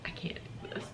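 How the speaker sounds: whispering through tears; voiceless, broken whisper of giving up; a retold tearful whisper. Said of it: "I just started crying again".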